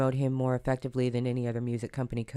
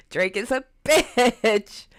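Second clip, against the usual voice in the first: laughing; 10 semitones higher, 7 dB louder, much slower than usual. Real, not acted. Mocking shout about [name].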